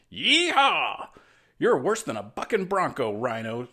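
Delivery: whooping high rodeo yell; cowboy yeehaw shout. A narrator's reading. Spider-Man whoops at Rhino like a rodeo rider. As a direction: ride him like a bucking bronco. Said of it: "Spider-Man shouted".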